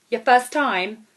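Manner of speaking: put-on high, loud, brassy holiday girl; brash loud woman. Airport stranger hailing the narrator, high and loud. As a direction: belt it out like a brash party girl.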